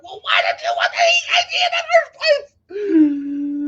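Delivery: high, loud indignant cry; exasperated. Retold or imitated. Patient questioning doctor after fine result, indignant cry. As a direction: fling it at her, incredulous.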